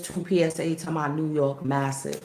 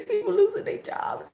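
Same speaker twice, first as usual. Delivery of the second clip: high giggling mock line; high laughing mock; 14 semitones higher than usual. Real, not acted. Laughing while mocking.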